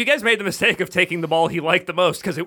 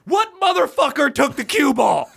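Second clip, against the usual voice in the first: raised-pitch accusing outburst; hammed-up indignation, pitched up; mock angry; 6 semitones higher than usual. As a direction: demand the culprit like an irate pool player. Acts out angry player in the bar.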